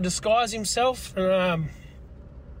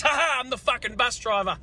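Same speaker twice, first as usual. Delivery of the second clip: gleeful reveal; 5 semitones higher, faster than usual. Retold or imitated. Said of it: "takes off her mask and says".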